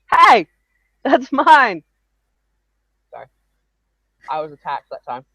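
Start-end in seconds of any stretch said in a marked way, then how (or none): 0.1-1.8 loud, high yelp of protest; real startled outburst. Real, not acted. Speaker attacked mid-story, startled loud protest. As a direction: cry out sharply in objection.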